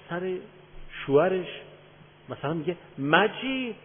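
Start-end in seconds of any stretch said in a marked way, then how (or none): 3.0-3.7 high, loud, slow bossy order; retold wife loudly bossing her husband; commanding tone. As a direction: order the husband around in front of guests. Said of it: "با حالت تحکم".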